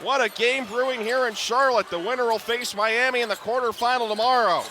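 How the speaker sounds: normal talking voice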